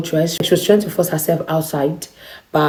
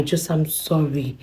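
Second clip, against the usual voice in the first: softer and slow, imitating a tearful sorry; a touch of weepy begging, soft and slow; tearful apology; 7 dB quieter, slower than usual. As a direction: drag out her crying apology quietly. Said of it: "She started to cry".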